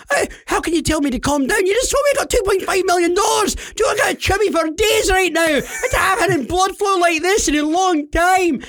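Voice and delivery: high-pitched hammed-up yelling; mock-hysterical excitement; all excited. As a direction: protest like an overexcited prize winner. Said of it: "I'm all excited".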